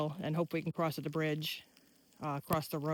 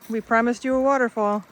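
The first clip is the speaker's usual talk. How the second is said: loud, proud announcement; loud announcing; 4 semitones higher, 11 dB louder than usual. Real, not acted. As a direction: call it out proudly. Announcing to viewers.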